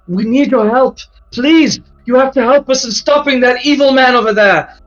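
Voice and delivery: high, loud, frantic plea for help; Dolores pleading. Acted. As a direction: beg the heroes in a high, panicked voice. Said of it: "She yells at you".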